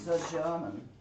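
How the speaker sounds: imitated German accent, a bit unsure; attempted German accent. Shown as a demonstration. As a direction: echo someone's put-on German accent. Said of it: "I'm not so good at that accent".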